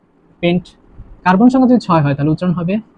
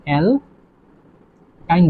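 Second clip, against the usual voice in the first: অ্যালকাইন very slowly, long gap after the first syllable; drawn-out dictation; 3 dB quieter, much slower than usual. Shown as a demonstration. Teacher giving students the answer, অ্যালকাইন slowly in pieces. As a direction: break অ্যালকাইন into two separated syllables. Teacher sounding out a new term.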